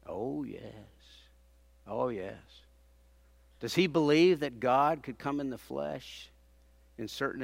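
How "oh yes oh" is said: ordinary talk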